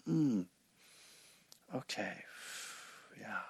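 very soft, spaced-out murmurs; gentle acceptance. Acted. Meditation teacher modelling how to hold a feeling, gently. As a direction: quietly hold the feeling, with long pauses. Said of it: "like, gently".

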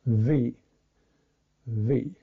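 a buzzing "V"; v sound. Shown as a demonstration. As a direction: buzz the lip on the teeth for "V". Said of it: "the V sound".

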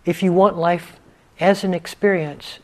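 unhurried meditation guidance with long pauses; guided meditation. Real, not acted. Teacher leading students into stillness, guided meditation voice. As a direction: guide them gently into stillness, leaving space between phrases.

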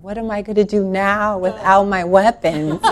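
laughing. Real, not acted. Laughing at her own question.

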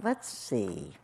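low, soft musing; quiet, falling murmur. A narrator's reading. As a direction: murmur it as if thinking to yourself. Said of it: "murmured Horton".

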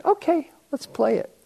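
lightly voiced high mother, game; a hint of the mother, high and willing; mom agreeing. Mother agreeing to her son's game, lightly high-voiced.

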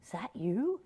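hushed, breathy rising question; timid questioning. Acted. Man on the cliff asking the heavens, hushed and tentative. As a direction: ask it tentatively, almost whispered. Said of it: "and the man says".

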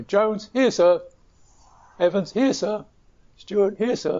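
high-pitched roll-call call-and-answer; school roll call. Retold or imitated. Teacher reads names and little boys answer at school, high-pitched roll-call voice. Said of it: "teacher would come in and read out the names".

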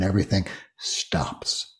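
soft breathy whisper as words drop away. Acted. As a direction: let the phrase dissolve into a whisper. Silence after speech falls away.

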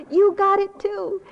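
high, loud cheer breaking into laughter; imitated child's yell. Granddaughter yelling encouragement to Nana, laughing imitated yell. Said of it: "She was yelling".

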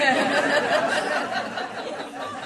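a long breathy laugh without voice; airy laugh. Real, not acted. Laughs after her joke.

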